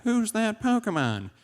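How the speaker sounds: high, slow, drawn-out TV catchphrase; cartoon quiz announcer. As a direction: sing out the show's teaser question. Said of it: "Remember when you were watching Pokemon".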